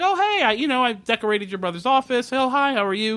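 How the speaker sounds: high, chirpy mock greeting; casual chirpy greeting. Woman comes downstairs and greets the boss, mock chirpy.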